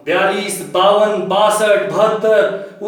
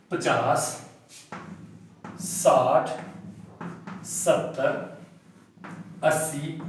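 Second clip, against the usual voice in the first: slow, lower and softer tens, long pauses; slow counting demo; 5 semitones lower, 6 dB quieter, much slower than usual. Shown as a demonstration. Teacher counting tens slowly for students to follow, lower and softer. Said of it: "मैं यहाँ आपको बताना चाहूंगा".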